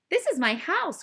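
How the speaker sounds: surprised to see his house; crying out. Acted. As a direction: call out at home. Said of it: "cried Digiduck".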